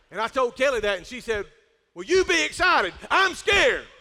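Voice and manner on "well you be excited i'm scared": loud, high mock protest; acted wifely retort. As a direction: throw it back at him, loudly, for comic effect. Acts wife's retort to his news.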